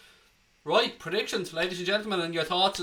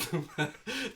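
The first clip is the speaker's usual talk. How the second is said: sudden laugh; 6 semitones higher, 6 dB quieter than usual. Real, not acted. Laughs at own slip.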